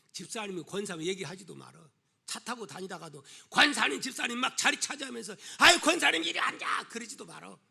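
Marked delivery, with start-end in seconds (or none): 5.6-6.9 high, loud mock holler; calling out. Churchgoer grabbing a seat and calling the elder over, calling out. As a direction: holler across the bus, hammed up.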